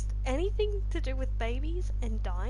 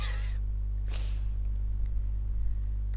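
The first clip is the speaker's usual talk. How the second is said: breathy laugh. Real, not acted. Laughing at weird animation.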